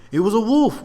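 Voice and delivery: high, loud and sure of himself; confident soldier. Acted. Soldier answering the others, loud and confident. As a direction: state it loudly like it's obvious. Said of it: "one of the others answered confidently".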